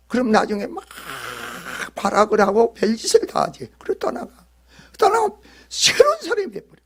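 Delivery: usual speaking voice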